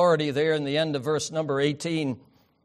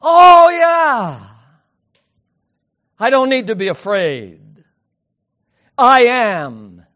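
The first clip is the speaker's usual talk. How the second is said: excited relief; 5 semitones higher, 13 dB louder, slower than usual. Acted. Voicing John's relieved reaction.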